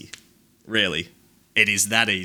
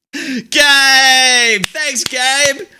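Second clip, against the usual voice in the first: higher, loud happy shout of a name; excited call-out; 10 semitones higher, 7 dB louder, much slower than usual. Real, not acted. Streamer greeting viewer Gabe in chat, loud excited call. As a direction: call out to him like spotting a friend.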